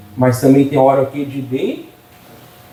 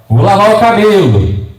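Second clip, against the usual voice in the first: louder, higher mock wife announcing it; imitating the wife; 4 semitones higher, 5 dB louder than usual. Pastor mocking how his wife says she'll wash her hair, loud and raised. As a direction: ham up the wife's big announcement. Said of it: "ela fala".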